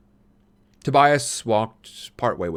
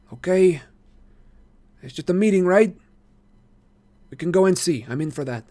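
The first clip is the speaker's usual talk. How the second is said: lightly voiced sly boy; 9 semitones higher, 4 dB louder than usual. A narrator's reading. Marco voiced in story.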